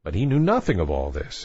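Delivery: plain reading voice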